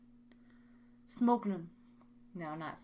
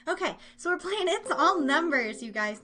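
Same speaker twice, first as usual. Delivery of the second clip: excited game announcement; 5 semitones higher, 6 dB louder than usual. Real, not acted. Announcing the game show round.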